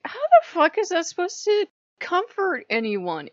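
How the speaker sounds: high, loud exasperated question; indignant disbelief. Retold or imitated. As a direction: throw it out high, utterly incredulous. Acted indignant reply to the teaching.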